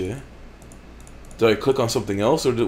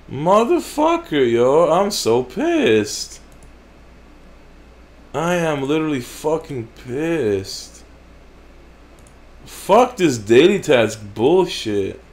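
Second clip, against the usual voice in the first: pissed off; 4 semitones higher, slower than usual. Real, not acted. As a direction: drag out each word in fury. Pissed off at game.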